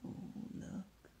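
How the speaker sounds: barely there whisper; whispered aside. Real, not acted. Aside pointing out the picture.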